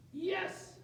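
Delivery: thrilled little yelp; excited yes. Real, not acted. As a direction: pounce on it with glee. Assistant picks right river.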